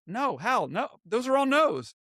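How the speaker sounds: high, emphatic string of no's; imitating someone dismissing every offer; retold firm refusal. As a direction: act out an agent's flat-out rejection.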